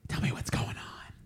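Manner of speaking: soft breathy whisper in the ear. Shown as a demonstration. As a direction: lean in and whisper it. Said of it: "I whisper it".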